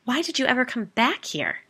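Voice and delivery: high, loud, incredulous demand. A narrator's reading. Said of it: "I demanded".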